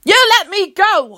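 high, loud defiant shout. A narrator's reading. Captive boy shouting at the vampires. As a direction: yell it at them. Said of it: "I shout".

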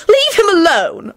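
high loud protesting cry. A narrator's reading. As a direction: protest it loudly. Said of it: "Elizabeth protested loudly".

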